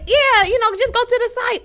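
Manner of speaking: high sing-song mimicry; mocking relatives. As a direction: parrot their advice in a high voice. Quoting relatives.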